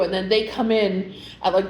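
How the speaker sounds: ordinary talk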